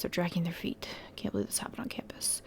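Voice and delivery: whispered. Acted. Reading a message aloud, hushed.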